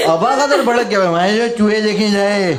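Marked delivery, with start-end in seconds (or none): 1.1-2.5 slow, mumbled, half-swallowed words; slurred Abakadar voice. Retold or imitated. Telling the crowd how furious Abakadar answered, slurred mumble. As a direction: mush the words like a dazed guy.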